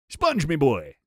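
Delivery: loud attempt at a cartoon crab's rasp; Mr. Krabs. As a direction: push out a raspy cartoon voice. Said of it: "that's an insane Krabs impression".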